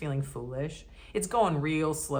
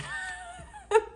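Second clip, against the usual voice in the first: high laugh. Real, not acted. Bursts into laughter.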